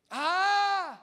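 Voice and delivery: a sudden loud cry of despair; loud anguished cry. Acted. Pastor voicing the prodigal son's anguished cry. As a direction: let out a loud pained "ah".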